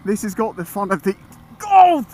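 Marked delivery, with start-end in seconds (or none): 1.6-2.1 loud, high-pitched "oh!"; sudden yelp that cuts off the sentence; startled yelp. Real, not acted. Rider jolted while praising the bike, startled yelp. Cut off by a jolt mid-sentence.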